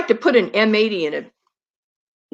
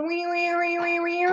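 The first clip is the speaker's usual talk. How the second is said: high, soft mock siren "wee wee"; playful high siren whine; high siren wail imitation; 9 semitones higher, 7 dB quieter than usual. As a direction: imitate the siren like a crying kitty. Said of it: "Did the kitty go".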